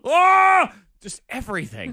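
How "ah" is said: pained shout. Retold or imitated. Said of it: "I just sit there".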